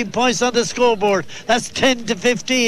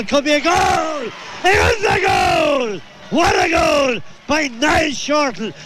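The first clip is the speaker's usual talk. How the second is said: higher, shouting as the goal goes in; excited goal call; 4 semitones higher, slower than usual. Real, not acted. Goal scored, commentator erupts.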